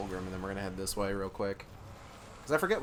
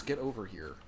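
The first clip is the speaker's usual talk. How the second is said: Scorpion voice; 5 dB quieter than usual. Retold or imitated. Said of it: "Scorpion voice".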